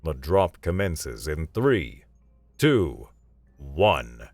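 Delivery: slow, deliberate countdown over a speaker; speaker announcement. Acted. Valerius counts the team down to the drop, speaker announcement. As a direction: count them down like a launch announcer. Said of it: "Valerius's voice echoed from a speaker".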